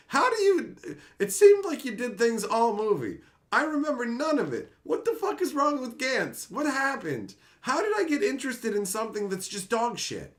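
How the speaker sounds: high-pitched, incredulous outrage; exasperated rant. Retold or imitated. Disappointed viewer demands how Gantz got so bad, exasperated high-pitched rant. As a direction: re-enact baffled fury in a high voice.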